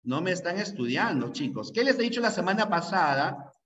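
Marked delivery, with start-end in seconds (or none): none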